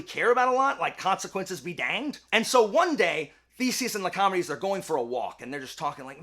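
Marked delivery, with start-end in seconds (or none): none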